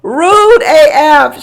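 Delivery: slow, high, loud disdain; appalled drawn-out judgment; outraged verdict. Real, not acted. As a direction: drag it out in disbelief. Outraged remark.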